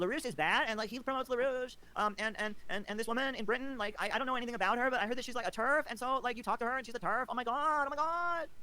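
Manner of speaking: high, hammed-up whiny rant; mocking whiny voice. Maupin parodying his critic's complaints, high and whiny.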